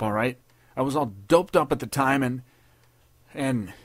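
high, loud desperate pleading. A narrator's reading. Private begging his captain not to report him. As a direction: beg the captain for a break.

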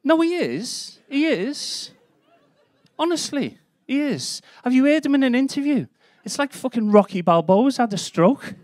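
very high-pitched comic protest; protesting insistence. Acted. Comedian defends his Harry Kane jibe to the crowd, high-pitched insistence. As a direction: plead your case in a squeaky-high voice.

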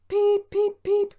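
very high, loud imitated engine whistle; piercing spoken toots; whistle peeps. A narrator's reading. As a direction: peep like a little steam whistle. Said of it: "whistled Peter Sam".

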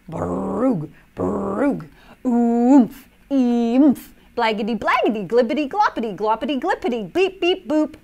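loud, wild nonsense noises; silly sounds. Acted. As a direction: babble like a cartoon creature. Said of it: "make silly sounds like".

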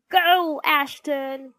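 high, loud fan cheer; shrieking. A narrator's reading. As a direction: shriek support for your team. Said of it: "shrieked Horrid Henry".

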